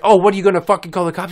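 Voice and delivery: rapid-fire mocking challenge; mock taunt. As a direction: jeer it in a put-on neighbor voice. Mimicking her taunt.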